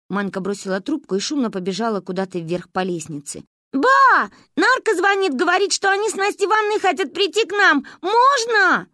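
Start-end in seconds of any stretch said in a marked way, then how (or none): 3.7-8.9 put-on high, loud child's shout up the stairs; girl shouting up to grandma; calling upstairs. A narrator's reading. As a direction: yell up to grandma.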